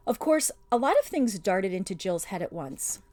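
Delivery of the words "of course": plain reading voice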